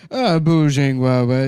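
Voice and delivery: put-on loud, drunken gibberish; drunk babble. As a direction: slur it like he's trashed. Drunk man rambling fake Chinese.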